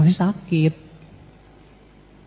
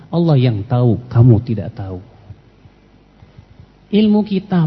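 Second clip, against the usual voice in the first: ordinary talk, nothing marked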